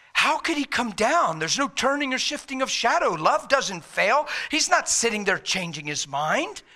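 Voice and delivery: fired-up rhetorical insistence. Real, not acted. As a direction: preach it as an incredulous challenge. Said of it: "I'm a little fired up".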